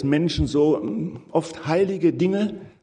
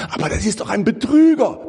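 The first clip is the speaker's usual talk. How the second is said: higher and louder, mock outrage; indignant objection; 7 semitones higher, 4 dB louder, faster than usual. Preacher voices listeners' objection, mock indignant. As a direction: protest as if the congregation cries foul.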